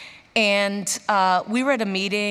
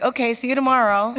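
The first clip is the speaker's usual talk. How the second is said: breezy brush-off; 6 semitones higher, 7 dB louder than usual. Retold or imitated. Boss brushes off her confession.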